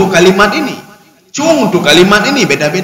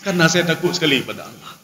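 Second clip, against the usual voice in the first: much softer "Karena saya takut sekali pada Allah"; pious answer; 4 semitones lower, 9 dB quieter than usual. Pretend. Preacher voicing a believer's answer, much softer. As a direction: answer humbly, dropping the voice low. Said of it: "Kenapa salat".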